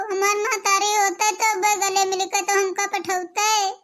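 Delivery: ordinary talk, nothing marked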